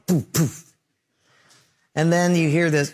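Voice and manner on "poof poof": two short engine pops; imitated engine cutting out; sputtering engine noise. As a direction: puff out the second engine failing. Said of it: "you hear the other one".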